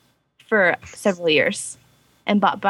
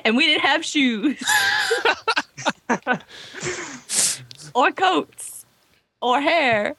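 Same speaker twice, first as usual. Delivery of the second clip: put-on laughing old-timer; 6 semitones higher, slower than usual. Old-timer hardship bit, laughing.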